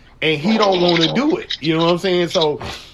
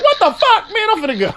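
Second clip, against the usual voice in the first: shocked disbelief. Retold or imitated. Retold disbelief at late bedtime.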